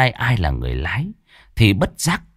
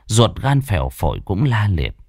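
ordinary delivery, nothing marked

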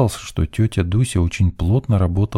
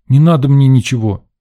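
higher, louder protest; boy's angry refusal; 6 semitones higher, 6 dB louder than usual. A narrator's reading. Boy refuses the girl's candy, loud and cross. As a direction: push it away loudly like a cross kid. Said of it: "Рыжов дёрнул плечом".

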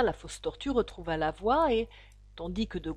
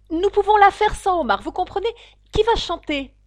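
loud, high, eager voice; excited; 10 semitones higher, 11 dB louder than usual. A narrator's reading. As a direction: urge them on excitedly. Fausse tortue excitée avant la danse. (voix forte et aiguë, pleine d'entrain)

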